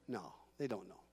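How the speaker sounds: hushed aside. Shown as a demonstration. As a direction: let it fall almost silent. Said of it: "repeated answer, dropped to a hush".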